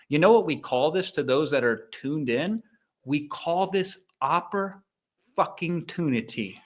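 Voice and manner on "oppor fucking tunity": oppor-fucking-tunity with fucking punched, slow; slow, deliberate oppor-fucking-tunity with the middle word hit; stressed infix. Shown as a demonstration. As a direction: lean hard on the fucking inside oppor-fucking-tunity. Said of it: "I stress that middle word".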